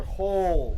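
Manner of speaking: "Hold." said loud and firm, falling; bark-free firm "Hold." as an order; firm command. Shown as a demonstration. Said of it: "her firmness was simply tone".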